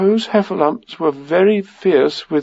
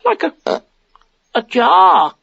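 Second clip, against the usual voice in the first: very high, squeaky, halting stammer; panicky Piglet. A narrator's reading. Piglet describing the heffalump to Christopher Robin, flustered. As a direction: squeak it out, groping for words between puffs.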